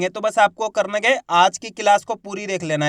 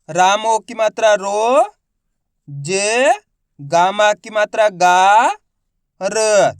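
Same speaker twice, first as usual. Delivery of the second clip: each syllable stretched and louder; slow syllable drill; 4 dB louder, much slower than usual. Shown as a demonstration. Teacher asks pupils to copy his slow sounding out. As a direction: sound out every piece slowly. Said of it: "मेरे तरीके पे गौर करना है".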